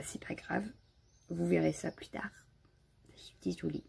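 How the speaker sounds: excuses soufflées, tout bas; s'excuser en chuchotant; chuchoté. Real, not acted. (excuses chuchotées aux spectateurs)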